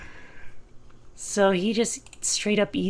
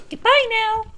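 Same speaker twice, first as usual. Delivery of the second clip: high squeaky voice; 5 dB louder than usual. Acted. Character farewell in high voice.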